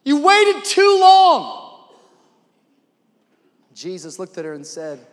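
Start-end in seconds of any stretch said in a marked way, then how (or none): 0.0-1.9 high, loud, drawn-out accusation; upset, frustrated. Acted. Martha blaming Jesus for delay, frustrated cry. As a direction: wail it at him, stretching the last words. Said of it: "Martha is frustrated, and she's saying".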